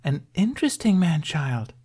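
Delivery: high, delighted mermaid remark. A narrator's reading. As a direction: savour the words, charmed. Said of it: "stated with delight".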